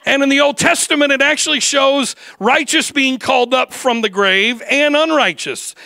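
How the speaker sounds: ringing pulpit voice; full-force preaching. Acted. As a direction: drive it home to the congregation.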